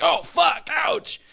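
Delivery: high startled shout of pain; surprised yelp. Retold or imitated. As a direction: yelp it out caught off guard. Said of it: "you'd be like".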